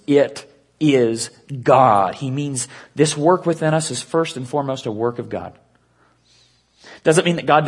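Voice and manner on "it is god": "It is God" slow, each word weighted; "It is God" spoken slowly, every word stressed; emphasis on It is God. Shown as a demonstration. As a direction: lean hard on "It is God". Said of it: "puts emphasis on the words".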